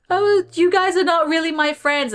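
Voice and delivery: high, loud wailing mock of a crying girl; mimicking the crying birthday girl. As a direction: wail it like a hurt teenager. Crying girl voiced in story.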